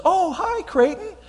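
high-pitched delighted greeting; mother answering phone. Retold or imitated. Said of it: "she picks it up, and she goes".

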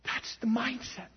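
slow, soft whisper; hushed whisper. Real, not acted. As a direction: drop to a whisper for emphasis. Hushed drop after mock shouting.

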